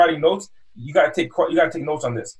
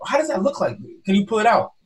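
coaxing curiosity; 6 semitones higher than usual. Acted. Salesman coaxing customer to show phone.

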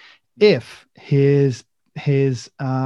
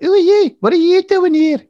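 very high, loud imitation of Liverpool kids; mock street kids; 8 dB louder than usual. Kids on bikes challenging strangers, in mock high kid voices. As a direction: squawk it like a cheeky kid, hammed up. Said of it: "all these kids, like, came up".

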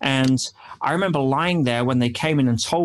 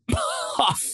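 burst of laughter; 3 dB quieter than usual. Real, not acted. Laughs at his own story.

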